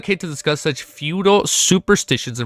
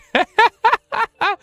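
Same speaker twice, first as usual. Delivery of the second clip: real high-pitched laughing fit; helpless laughter; 4 dB louder than usual. Real, not acted. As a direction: break into a squealing laugh. Amused by own realization.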